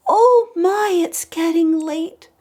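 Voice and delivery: high-pitched fretting pumpkin; worried little pumpkin. Acted. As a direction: squeak it out like a tiny pumpkin. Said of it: "The first one said".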